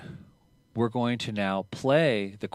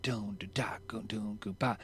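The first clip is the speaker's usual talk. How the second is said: vocalized shuffle beat; 8 dB quieter than usual. Retold or imitated. Drummer illustrating a shuffle beat from a song, soft vocal drum imitation. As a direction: drum groove sung out softly in syllables. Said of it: "he plays a shuffle beat".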